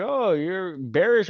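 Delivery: high, drawn-out mock objection; mocking critics. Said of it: "people are like".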